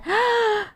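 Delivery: sharp, high gasp; mock-scandalised intake of breath; shocked gasp. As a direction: gasp in affront. Shocked reply to chat message.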